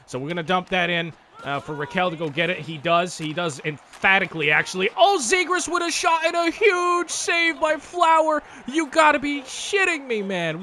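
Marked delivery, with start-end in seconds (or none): 4.9-10.2 high, slowed exclamation of disbelief; disbelieving excitement. Real, not acted. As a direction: cry out at the robbed chance. Reacting to a huge save.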